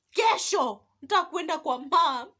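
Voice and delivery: high, very loud mock wail; tearful demand. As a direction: cry out like a child demanding mom.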